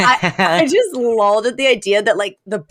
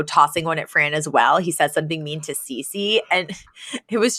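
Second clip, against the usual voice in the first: normal talking voice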